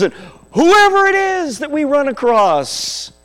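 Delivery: emphatic preaching, rising to loud and high; building sermon appeal. Real, not acted. Preacher urging congregation to love all peoples, preaching that builds to full force. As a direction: build each line to an impassioned peak.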